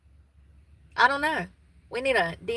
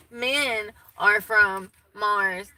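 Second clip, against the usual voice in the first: slow; 3 dB louder, much slower than usual. Pretend. Quoting a book title.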